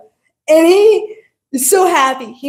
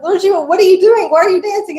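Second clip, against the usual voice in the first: a touch higher, wife questioning her husband; lightly voiced Parvati; 6 semitones higher, faster than usual. Wife asking Lord Shiva why he dances, lightly voiced. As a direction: lightly play the wife pressing him. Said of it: "his wife's like".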